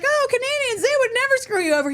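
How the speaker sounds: very high, fast gushing mimicry; mock naive praise. Podcaster mimicking people who trust Canadians, mock naive. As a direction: imitate a gullible believer in a high voice.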